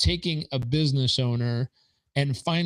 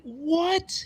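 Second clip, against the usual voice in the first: much higher, stunned what; shocked what. Retold or imitated. Hearing he paid to go to work, shocked and high. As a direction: hit it as total disbelief. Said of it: "I was like".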